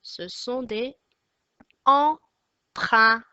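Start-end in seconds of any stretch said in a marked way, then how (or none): 1.8-3.2 "empreintes" in three loud, spaced beats; syllable by syllable. Shown as a demonstration. Teacher names the last drawing, syllable by syllable. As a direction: break "empreintes" into em, prein, tes with pauses. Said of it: "Trois syllabes".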